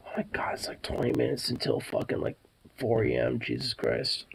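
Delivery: hushed. Real, not acted. Late-night quiet.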